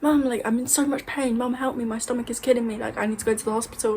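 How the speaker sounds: high mock cry for help, easing off as it goes; retold screaming for mum. Child calls mum for help in pain, mock screaming. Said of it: "I started screaming".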